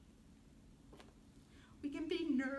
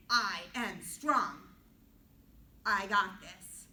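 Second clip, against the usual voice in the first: slow, much louder, strong declaration; confident; 5 semitones lower, 9 dB louder, slower than usual. Shown as a demonstration. Teacher showing kids how confidence sounds, loud and slow. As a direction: boom it out like you mean it. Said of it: "I'm being really confident".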